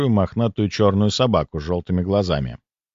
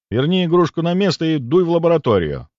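commanding; 7 semitones higher, 3 dB louder than usual. Acted. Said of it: "мысленно велел я".

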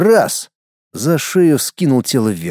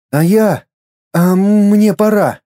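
put-on higher, slower boy voice, resigned; boy's inner voice; 7 semitones higher, 3 dB louder, slower than usual. Inner boy telling Skat it is his time, higher and slow. Мальчик прощается.

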